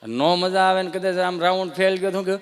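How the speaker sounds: ordinary talk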